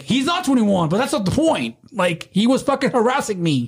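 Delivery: high-pitched exasperated insistence; retold indignant protest. Doorman justifying himself to the guy's buddy, high indignation.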